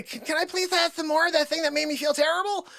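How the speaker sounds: high, quick whiny imitation; mocking pleading. Coworker begs for another shot, mock pleading. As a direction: beg in a put-on high voice. Mockingly voices Joanne begging for more.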